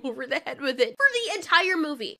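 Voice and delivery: ordinary talk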